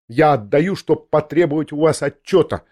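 plain reading voice

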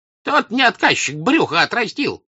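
high, loud jeer; scornful high-pitched heckle; jeering retort. A narrator's reading. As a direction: throw his words back in a high shout. Peasant throws Dron's words back.

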